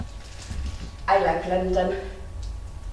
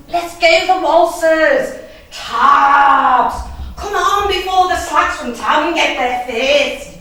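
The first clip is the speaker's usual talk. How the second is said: high, loud and giddy; excited teen; 7 semitones higher, 6 dB louder, slower than usual. Retold or imitated. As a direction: rush your friend out the door. Excited teens heading to the fair.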